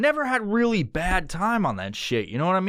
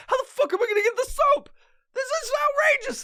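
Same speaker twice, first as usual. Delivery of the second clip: much higher, fast, squeaky panic; high freaked-out voice; faster than usual. Retold or imitated. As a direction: squeal it in mock outrage, fast. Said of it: "and I was like".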